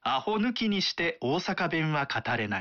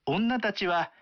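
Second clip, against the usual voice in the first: plain reading voice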